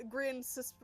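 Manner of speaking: ordinary delivery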